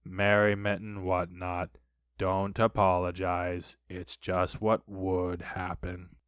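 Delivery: slow, low, gloomy monotone; mournful low donkey voice; bitter Eeyore. A narrator's reading. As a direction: drag it out with resigned bitterness. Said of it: "said Eeyore bitterly".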